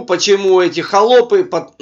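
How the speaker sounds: usual speaking voice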